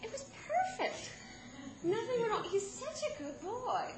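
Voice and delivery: high, slow sugary "It was perfect"; mock the sitter's too-sweet report; imitating babysitter. Mother imitating a lying babysitter, high and syrupy. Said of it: "And they go".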